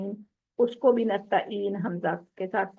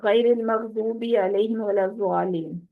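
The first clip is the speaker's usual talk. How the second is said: rushed recitation, الضالین said as زالین with a ز; hasty recitation. Shown as a demonstration. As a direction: gabble it like a hurried reader. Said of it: "جب جلدی میں پڑھتے ہیں".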